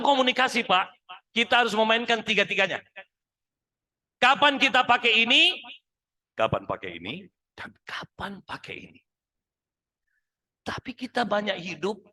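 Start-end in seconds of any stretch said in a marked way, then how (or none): 4.2-5.6 loud and higher; full voice. Shown as a demonstration. Trainer shows the full vocal voice to managers, loud and high. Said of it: "vokal full". 6.3-7.2 natural voice. Shown as a demonstration. Trainer shows the natural voice to managers, lower and calm. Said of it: "natural voice". 7.5-9.0 soft persuasive whisper. Shown as a demonstration. Trainer shows the persuasive voice to managers, whispered. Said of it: "persuasive voice".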